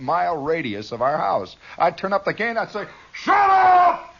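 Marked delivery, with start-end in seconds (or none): 3.1-4.1 very high, loud shout; amplified yell. Retold or imitated. Kid testing the loudest voice around, high yell. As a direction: blast it out over a homemade PA. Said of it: "I had the loudest voice".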